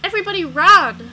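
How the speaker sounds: high, loud panicked yell; loud shout. Acted. Kid yells at the crowd to run, panicked. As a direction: scream it to the whole crowd.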